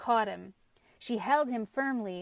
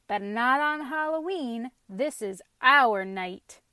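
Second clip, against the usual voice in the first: loud, emphatic declaration; crying out; 2 semitones higher, 7 dB louder than usual. A narrator's reading. Vegetables claiming Halloween night, defiant cry. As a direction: proclaim it, leaning on the key words. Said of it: "they cried".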